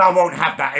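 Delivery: usual speaking voice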